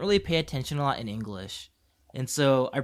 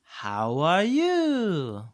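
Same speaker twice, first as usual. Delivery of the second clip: extremely slow, drawn-out Japanese-accented greeting; exaggerated Japanese accent; 4 semitones higher, 3 dB louder, much slower than usual. Shown as a demonstration. Showing the accent kids understand, exaggerated Japanese accent. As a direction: stretch every vowel in a put-on Japanese accent. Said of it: "I think it's the accent too".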